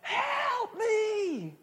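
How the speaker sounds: high, long-drawn cry of terror; imitating an injured man's desperate, high-pitched wail; imitated scream for help. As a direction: cry out from the top of your lungs, stretching both words. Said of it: "He was crying from the top of his lungs".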